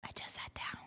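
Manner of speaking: barely audible, voiceless repeat; whispered complaint. Real, not acted. Owner repeating the protest to the dog, whispered. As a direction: breathe it out under your voice.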